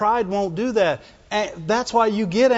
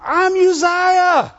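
high, puffed-up bragging king; boastful Uzziah; 7 semitones higher than usual. Retold or imitated. Preacher voicing King Uzziah defying the priests, boastful. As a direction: brag like a spoiled king who can't be told no. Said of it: "because he wanted".

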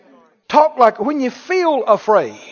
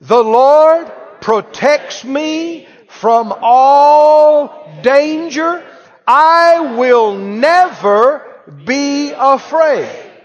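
loud, high, very drawn-out proclamation; full-force preacher leading a congregation; out-loud declaration; 7 semitones higher, 6 dB louder, much slower than usual. Shown as a demonstration. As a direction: belt each word slowly for all to join. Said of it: "Say it out loud".

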